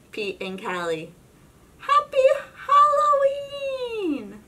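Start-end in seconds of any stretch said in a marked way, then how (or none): 1.8-4.4 high, slow loud singing greeting; high drawn-out shout; teacher's greeting. Acted. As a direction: hail the kids from the door.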